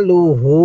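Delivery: লু kept short, হু held one beat longer; don't stretch লু, lengthen the vowel of হু; brief লু then drawn-out হু. Shown as a demonstration. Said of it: "লামের মধ্যে কিন্তু টান দিবেন না, হায়ের মধ্যে এক আলিফ টান".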